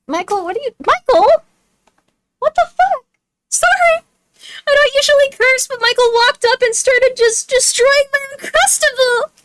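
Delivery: very high, loud, upset outburst; distraught. Real, not acted. Streamer scolding Michael for wrecking the Uncrustable, distraught. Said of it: "You sound distraught".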